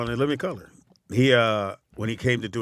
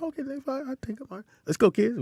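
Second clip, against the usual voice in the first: high, light mock agreement; 14 semitones higher, 6 dB quieter, faster than usual. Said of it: "I said".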